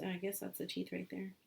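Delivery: ordinary talk, nothing marked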